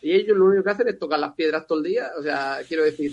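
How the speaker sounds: ordinary delivery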